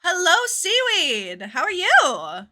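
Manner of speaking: cheery hello for a newcomer; bright greeting. Real, not acted. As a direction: call out a big hello to a viewer. Greeting a new viewer.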